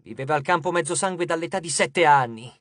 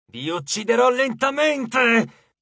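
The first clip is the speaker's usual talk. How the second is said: high, slow goddess growl; grunted threat; 5 semitones higher, 3 dB louder, much slower than usual. A narrator's reading. Enraged goddess threatens the two demigods, grunting. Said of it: "grugnì".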